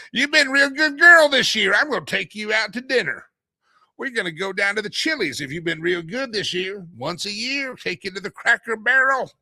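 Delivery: high, loud mock good-ol'-boy husband; condescending husband. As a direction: talk down like a smug husband. Mock husband addressing wife.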